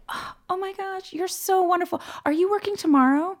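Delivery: high, gushing imitation of a grateful guest; delighted old lady. Old lady delighted with her server.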